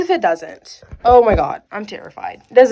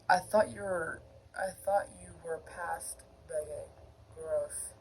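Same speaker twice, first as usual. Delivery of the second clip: Sangwo; 5 semitones lower, 16 dB quieter than usual. Acted. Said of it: "I cannot do a Sangwo voice".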